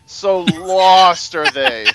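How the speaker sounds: loud, drawn-out sermon parody; mock-pious proclamation. Mocking a preacher lamenting the proud unbelievers, hammed-up and booming. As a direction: boom it out slowly, hammed-up holy lament.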